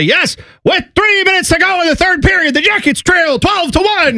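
high, bright-sold announcer imitation of a losing score; mock upbeat announcer. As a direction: sell a blowout like great news. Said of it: "he would say".

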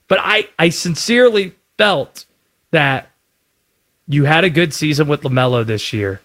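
ordinary talk

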